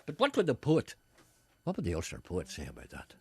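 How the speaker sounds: usual speaking voice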